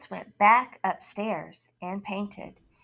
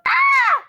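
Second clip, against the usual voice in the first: frightened scream; 9 dB louder than usual. Acted. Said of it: "And the man yelled".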